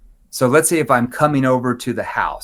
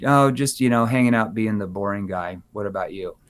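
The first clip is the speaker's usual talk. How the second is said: flat, dull tone; 4 semitones lower than usual. Shown as a demonstration. Agent answering the prospect with flat, bored tone. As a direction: say it like you couldn't care less. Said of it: "if I said it like this".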